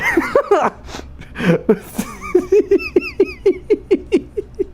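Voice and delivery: very high wheezing laugh; helpless laughing fit. Real, not acted. Streamer cracks up at "Wheelay", high wheezing laugh. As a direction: squeal and wheeze with long laughter.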